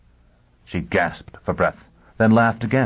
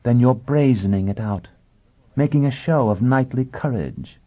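lightly amused, higher lady's voice; husky amusement; 6 semitones higher than usual. A narrator's reading. Noblewoman teasing a scared but defiant guest, amused. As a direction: tease him with a touch of mockery. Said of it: "The husky voice sounded amused".

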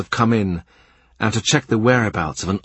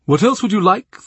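higher, louder indulgent host; the Cardinal's offer; 11 semitones higher, 5 dB louder than usual. A narrator's reading. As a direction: ask like a generous prince. Cardinal asks Cromwell.

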